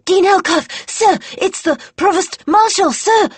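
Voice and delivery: put-on high, loud, breathless little boy; a small boy gasping out his news to the dean, high and breathless; breathless boy's treble. A narrator's reading. Boy runs up to the dean, shrill breathless treble. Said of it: "the boy said in a breathless treble".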